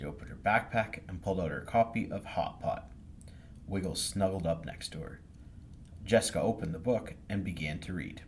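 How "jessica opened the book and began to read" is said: ordinary delivery, nothing marked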